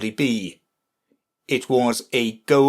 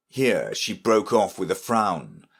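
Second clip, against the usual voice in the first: ordinary delivery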